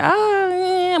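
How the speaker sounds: high teary mock sob of a contestant; mock crying. Imitating a crying housemate to a friend, mock sob. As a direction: imitate a crying man, lightly.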